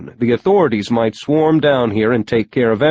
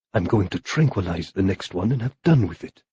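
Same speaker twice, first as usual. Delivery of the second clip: low, soft grumbling villain; quiet cursing, half to himself; muttering; 4 semitones lower, 5 dB quieter than usual. A narrator's reading. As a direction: mutter it under your breath, lower and softer. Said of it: "he muttered".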